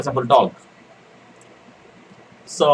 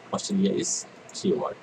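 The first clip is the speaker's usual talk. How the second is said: soft unvoiced murmur; quiet reminder under the breath; whispered aside; 4 semitones lower, 8 dB quieter than usual. Real, not acted. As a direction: breathe it as a note to yourself.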